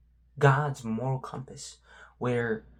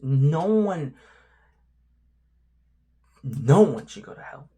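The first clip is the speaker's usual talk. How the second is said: louder, insistent declaration; emphatic; 2 semitones higher, 7 dB louder than usual. Real, not acted. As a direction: drive the point home with force.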